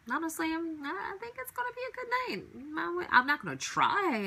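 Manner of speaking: bubbling over with anticipation; a playful squeal of excitement; giddy excitement. Real, not acted. Giddy about her night ahead.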